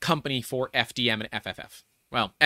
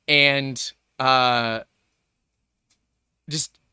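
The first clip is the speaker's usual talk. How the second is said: ordinary talk, nothing marked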